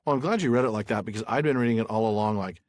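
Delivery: normal talking voice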